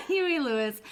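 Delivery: laughing out the words. Real, not acted. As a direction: giggle through it. Laughing while flexing bicep.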